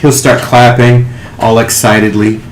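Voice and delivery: ordinary delivery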